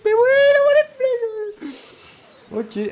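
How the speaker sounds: high, slow, drawn-out mock wail. Gamer to viewers as the arrows hit, hammed-up high wail. Wails as opponent fires arrows.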